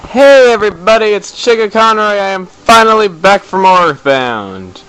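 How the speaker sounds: hammed-up high-pitched character intro; silly intro voice. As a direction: greet the viewers in a goofy high character voice. Playful put-on intro voice.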